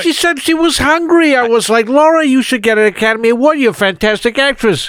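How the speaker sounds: put-on high character voice; high comic impression voice. Impression skit, Lucas voice.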